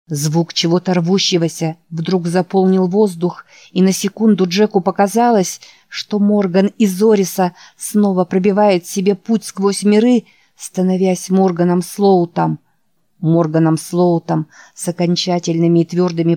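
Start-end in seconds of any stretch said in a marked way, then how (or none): none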